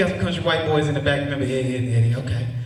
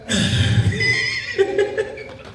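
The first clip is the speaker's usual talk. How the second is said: high cackle. Real, not acted. Laughs at own joke.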